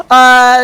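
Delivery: loud startled cry; scream. Acted. Kid screaming as the car flies away. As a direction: yell as the car takes off.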